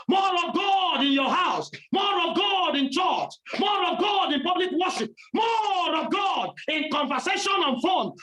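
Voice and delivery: high, ringing preacher's cry; fiery preaching. Acted. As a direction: preach it out, line after line.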